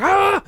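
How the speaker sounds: short high imitated scream of the lady; mock yell. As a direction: let out her sudden high yell. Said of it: "She's like".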